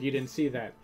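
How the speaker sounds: normal talking voice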